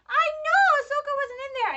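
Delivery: very high, loud cooing to a toy; playful high voice. Pretend. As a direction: answer a toy in mock sympathy. Answers a talking toy.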